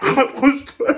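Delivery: laughing. Real, not acted. Laughing through face mask.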